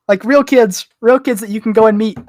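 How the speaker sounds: ordinary talk, nothing marked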